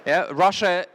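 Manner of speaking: ordinary delivery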